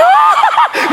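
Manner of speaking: very high mock fan squeal; swooning squeal. Imitating women reacting to the handsome pastor, swooning. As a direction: squeal like a smitten admirer. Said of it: "Yung mga babae".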